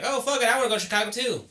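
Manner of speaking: high, fast whiny imitation; mock him in a high, quick whine; mocking friend. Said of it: "Ramsey's just like".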